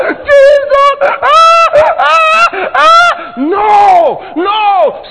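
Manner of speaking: high, loud mock lament over the dead boy; acted wailing. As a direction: wail Jesus and ah as a grieving mourner.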